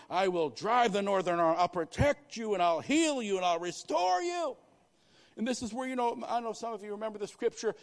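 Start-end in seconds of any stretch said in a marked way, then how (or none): 0.1-4.5 loud preaching. Acted. Preacher voicing God's promise.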